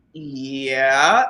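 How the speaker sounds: low, drawn-out wary yeah, rising; guarded, questioning drawl; suspicious. Retold or imitated. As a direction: answer the phone like you distrust the caller. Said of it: "Who the hell are you and what do you want?".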